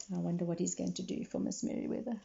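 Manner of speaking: plain reading voice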